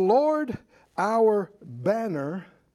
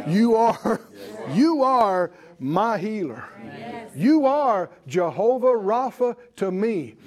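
emphatic, ringing declarations of faith; impassioned preaching; 4 semitones higher than usual. Real, not acted. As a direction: preach it out boldly in punchy phrases. Preaches with rising force.

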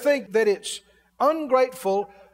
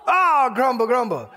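mock rant; 6 semitones higher than usual. Complaining about a leaky old car, hammed-up shouted rant. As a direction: rage at the old junk car.

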